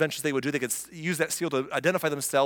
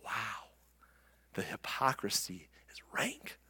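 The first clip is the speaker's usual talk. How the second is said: whispered aside; 6 semitones lower, 12 dB quieter, slower than usual. Real, not acted. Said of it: "whispered aside to congregation".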